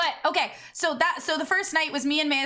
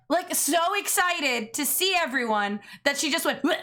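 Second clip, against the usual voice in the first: ordinary talk, nothing marked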